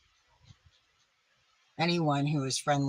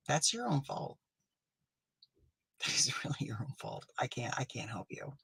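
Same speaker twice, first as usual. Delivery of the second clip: whisper; 5 dB quieter than usual. Acted. Smug dismissal of fan.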